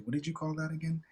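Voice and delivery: normal talking voice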